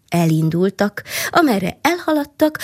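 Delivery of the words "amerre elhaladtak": plain reading voice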